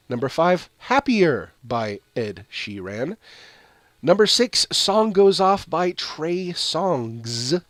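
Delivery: upbeat chart-countdown read; radio countdown announcer. Acted. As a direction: call out each ranking like a radio host. Announces the top song chart.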